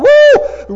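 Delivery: high, loud joyful woo; whooping as the caravan rolls out; excited whoop. Retold or imitated. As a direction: let out a celebrating whoop. Abram setting off excited.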